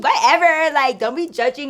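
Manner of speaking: loud, high mock-defensive retort; defensive brush-off. Friend told off for drinking, retold defensive brush-off. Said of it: "I remember her being like".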